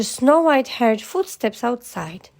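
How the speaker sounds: ordinary delivery, nothing marked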